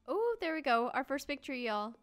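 loud, high burst of triumph; excited. Real, not acted. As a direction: cheer a first small victory. Celebrates felling first tree.

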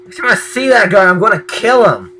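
high, loud frustrated threat; angry vow. Real, not acted. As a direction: loudly vow revenge on the enemy. Vowing revenge after dying.

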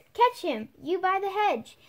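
high, loud barked order; rabbit shouting orders. A narrator's reading. The Rabbit ordering someone by the hedge, loud command. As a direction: yell the command across the garden. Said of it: "Then the rabbit's voice alone".

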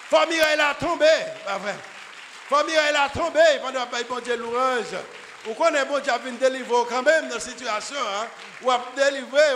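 loud, high fervent preaching; full-force preaching. Acted. Preacher driving home the fall of Jericho, fervent shout. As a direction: preach it to the rafters.

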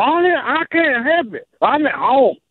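ordinary talk

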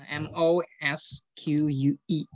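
M O S Q U E spelled out one letter at a time. Shown as a demonstration. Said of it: "they pray in the mosque".

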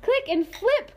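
high loud urgent shout; urgent cry. A narrator's reading. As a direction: call out urgently. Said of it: "cried the wise old click beetle".

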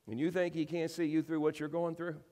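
ordinary delivery

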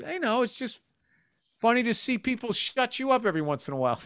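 high, mock wife voice; wife's voice. Wife ribbing her husband, high mock voice. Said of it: "she goes".

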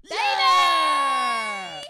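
loud drawn-out yeah; cheering shout. Real, not acted. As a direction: whoop it.

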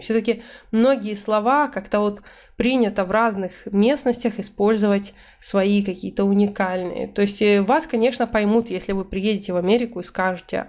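ordinary talk

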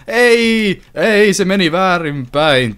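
sudden high loud "Ei!" then slow dismay; dismayed cry. Real, not acted. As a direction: cry out at the mistake. Game item falls wrong way. (järkyttynyt huudahdus)

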